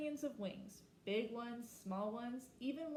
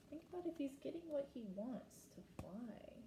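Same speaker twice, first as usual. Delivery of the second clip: soft whisper to the listeners; whispered aside; 4 semitones higher, 5 dB quieter than usual. Real, not acted. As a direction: lean in and whisper the question.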